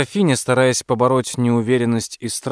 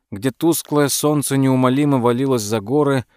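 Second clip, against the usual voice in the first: ordinary delivery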